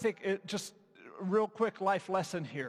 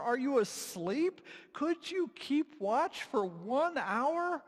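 high, drawn-out disbelieving questions; slow, wounded questioning of sleepers; incredulous reproach; 8 semitones higher, slower than usual. Acted. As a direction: chide them slowly in rising disbelief. Jesus chides the sleeping disciples.

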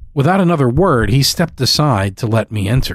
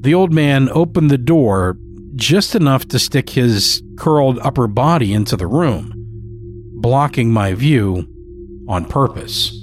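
ordinary delivery, nothing marked

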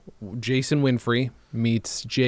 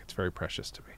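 whisper; 5 semitones lower, 11 dB quieter, faster than usual. Pretend. Mock sentimental remark about sock.

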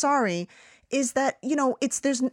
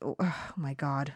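blanking on lines; 7 semitones lower, 10 dB quieter, slower than usual. Real, not acted. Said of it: "I'm completely blanking right now".

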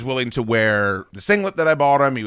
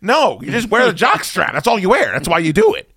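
higher, emphatic retold telling-off; exasperated insistence; 7 semitones higher, 4 dB louder than usual. Host retells instructing performer on the jock strap, exasperated. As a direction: lay down the rule in mock exasperation.

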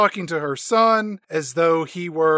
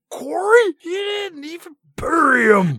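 high, loud, hammed-up horrified cry; mock horrified shout; 12 semitones higher, 4 dB louder than usual. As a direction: shriek out the brother's name in horror. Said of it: "he's like".

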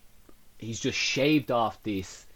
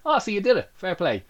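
surprised; 9 semitones higher, 5 dB louder than usual. Retold or imitated. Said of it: "It was like".

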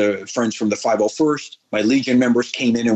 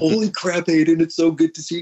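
excited greeting; 6 semitones higher, faster than usual. Retold or imitated. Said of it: "Hayden comes in, like".

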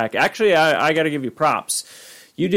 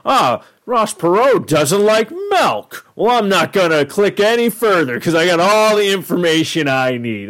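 hammed-up know-it-all, pitched up; mocking headline reader; 6 semitones higher, 3 dB louder than usual. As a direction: parody someone jumping to a silly conclusion. Mocks readers who only read headlines.

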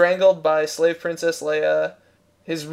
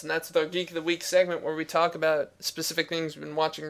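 ordinary talk, nothing marked

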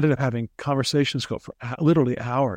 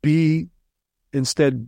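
usual speaking voice

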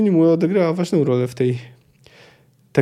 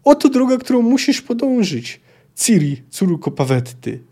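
urgent sorceress; 5 semitones higher than usual. A narrator's reading. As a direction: urge the rider on like an enchantress. Sorceress sends Ciri through portal.

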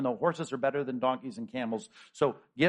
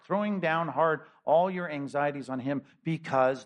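usual speaking voice

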